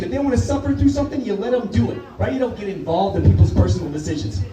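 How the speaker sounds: ordinary talk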